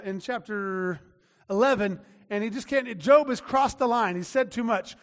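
normal talking voice

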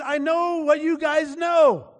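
high, slow, insistent comeback; exasperated retort. Acted. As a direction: act out Job fed up with his friends. Acted Job retorting to friends.

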